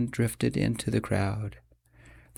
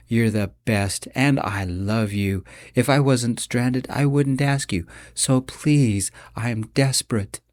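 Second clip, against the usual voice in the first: usual speaking voice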